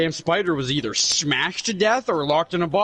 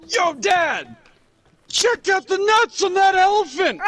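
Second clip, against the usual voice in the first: put-on shouted out much higher and louder, like a thrilled little kid; excited kid shout; 4 dB louder, slower than usual. Little boy yelling to his dad in the hushed circus crowd, high excited shout. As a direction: yell it across a silent circus tent like a five-year-old who just spotted something amazing. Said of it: "four or five-year-old me".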